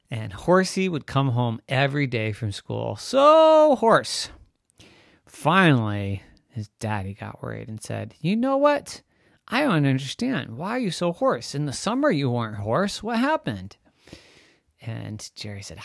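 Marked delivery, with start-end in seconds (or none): 3.0-4.3 high, loud teasing echo; sister's teasing voice. Acted. Narrator mimics key phrase. 8.2-13.8 high, puzzled father voice; worried daddy. Acted.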